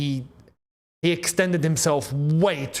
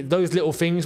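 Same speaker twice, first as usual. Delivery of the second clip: ordinary talk, nothing marked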